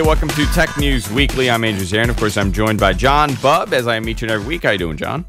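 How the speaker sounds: normal talking voice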